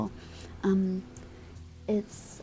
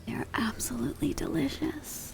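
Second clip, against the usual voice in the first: whispered thanks; 5 semitones higher, 7 dB quieter than usual. Acted. Whispered joke thanks to chat.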